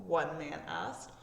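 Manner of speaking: usual speaking voice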